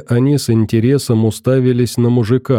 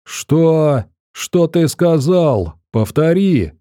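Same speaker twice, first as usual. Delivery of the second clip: higher-pitched outraged demand; mild narrated yell, pitched up; crying out; 5 semitones higher, slower than usual. A narrator's reading. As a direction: demand a repeat of the insult. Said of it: "выкрикнул начальник".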